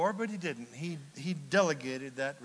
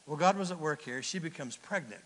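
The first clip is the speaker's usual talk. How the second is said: normal talking voice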